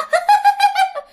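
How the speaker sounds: very high staccato giggle; piercing high spirit laugh; ghostly cackle. Acted. Ghost cackles after demanding child.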